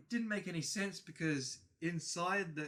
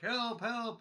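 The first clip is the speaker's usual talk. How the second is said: high mock plea for help; fake cry for help; 9 semitones higher, 3 dB louder than usual. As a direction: call out as a creature faking distress. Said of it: "it's all just fake".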